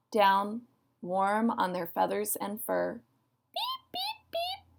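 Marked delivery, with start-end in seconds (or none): 3.5-4.6 very high, soft little beeps; tiny piping frog peeps, soft and far up high; high peeping. Acted. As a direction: peep softly in a tiny high squeak. The peeper's call, acted.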